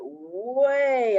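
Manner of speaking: WAY stretched long and loud; "way" elongated for storytelling effect; drawn-out emphasis. A narrator's reading. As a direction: hold the vowel of "way" in a rising-then-falling swoop. Narrator stretches the tail flick.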